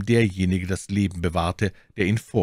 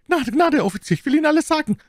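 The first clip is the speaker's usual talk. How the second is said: very high, fast, loud plea; panicked bandit babbling at high pitch; terrified begging; 5 dB louder, much faster than usual. Acted. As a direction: beg the officer for mercy. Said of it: "schrie der Bandit".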